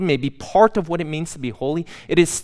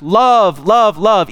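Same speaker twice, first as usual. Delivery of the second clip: louder, ringing cry of praise; seraphim crying out; 4 semitones higher, 5 dB louder, slower than usual. Retold or imitated. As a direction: call it out like an angel choir. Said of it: "don't cry out".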